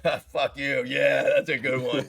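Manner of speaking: loud laugh running into the words; imitated hearty laughter. Captain amused by the actor's joke, hearty mock laughter. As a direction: laugh loudly, enjoying the insult. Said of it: "and he's like".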